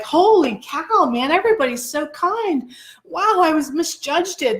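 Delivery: high, drawn-out wonder of a naive bird; gush, touched and amazed, hammed up; mock amazed gratitude. Voices grateful bird in story.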